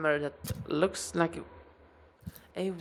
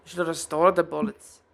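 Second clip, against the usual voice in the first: higher, louder helpful shopkeeper; dwarf smith voice; 4 semitones higher, 5 dB louder than usual. Acted. Dwarf explaining blue bullets to customer, higher and louder. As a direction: explain the glimmer cheerfully. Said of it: "GM as Goldrin explaining".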